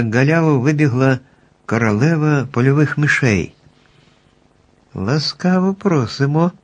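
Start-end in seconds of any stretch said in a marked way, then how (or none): 4.9-6.5 joyful exclamation. A narrator's reading. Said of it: "радісно вигукнули".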